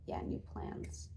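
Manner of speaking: whispered. Real, not acted. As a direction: breathe it to yourself as an afterthought. Echoes the phrase under her breath.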